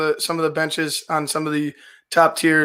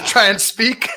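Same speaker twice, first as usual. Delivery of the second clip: asked through a laugh, higher; laughing question; 6 semitones higher, 4 dB louder, slower than usual. Real, not acted. Co-host teasing host about his accent, laughing question. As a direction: tease him while laughing.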